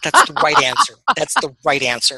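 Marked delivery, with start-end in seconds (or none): 0.0-0.9 high, loud approving cheer; excited approval. Real, not acted. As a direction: cheer it as the right answer. Approving Airwolf love.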